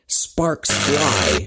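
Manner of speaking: ordinary delivery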